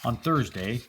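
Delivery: ordinary delivery, nothing marked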